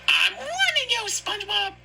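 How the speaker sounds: high, stern warning; warning Sandy voice. Acted. Sandy warning SpongeBob, high and stern. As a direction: threaten him in a high squeaky voice.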